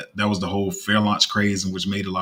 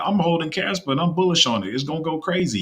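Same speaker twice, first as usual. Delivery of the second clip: high-pitched imitation of an eager holder; mock hype; 8 semitones higher than usual. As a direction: parody a naive investor's excitement, pitched up. Mocking naive holders' hype in a quote.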